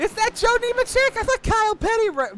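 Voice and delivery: very high, loud excited question. Real, not acted. Fan thrilled by the old car, very high excited questions. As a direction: pitch it way up in delight. Thrilled at a nineties paint scheme.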